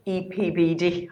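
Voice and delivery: measured, deliberate pace on the acronym; slow and careful. Real, not acted. Moderator carefully reading out a tongue-twister acronym, slowly. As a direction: spell out E P B D slowly, letter by letter. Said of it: "I have to say it slowly".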